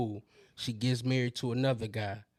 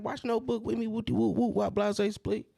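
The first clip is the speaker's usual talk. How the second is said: put-on much higher girlfriend voice, rattling off the plot; mimicking his girl; 8 semitones higher than usual. Girlfriend pitching the Notebook plot, very high mimic. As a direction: play her coaxing him, pitched way up. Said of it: "She like".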